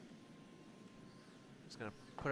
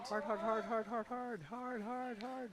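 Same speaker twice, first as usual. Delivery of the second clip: curling skip yelling; 12 semitones higher, 6 dB louder, slower than usual. Real, not acted. Skip calling sweepers on ice.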